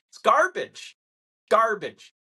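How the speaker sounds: high, loud "garbage"; disgusted dismissal. Real, not acted. Rejecting the unemployment headlines, disgusted. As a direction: spit out the word twice.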